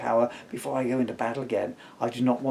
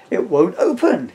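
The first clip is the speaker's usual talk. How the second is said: loud, slow and straining; loud, slow, strained; strained complaint; 7 semitones higher, 8 dB louder, slower than usual. Acted. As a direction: strain as if still twisting the lid. Peter straining at the jar.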